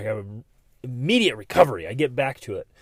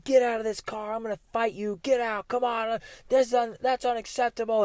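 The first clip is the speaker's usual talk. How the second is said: mock screaming and yelling; 8 semitones higher than usual. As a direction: act out a raging driver, held back in volume. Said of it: "Screaming and yelling".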